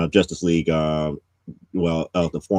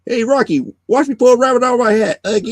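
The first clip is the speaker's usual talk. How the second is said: cartoon voice; 4 dB louder, faster than usual. Retold cartoon quote, high.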